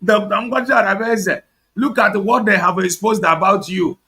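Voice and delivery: mock wailing mourner. Mocking social media mourners with a hammed-up wail. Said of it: "I want to cry like this very social media".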